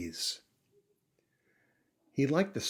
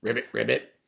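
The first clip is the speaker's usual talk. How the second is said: 1 frog croak; 5 dB louder than usual. Acted.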